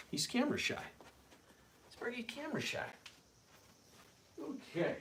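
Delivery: high, soft voice to a dog; coaxing pet talk. Real, not acted. Owner coaxing his camera-shy dog, high soft pet talk. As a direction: coo it softly to a shy pet.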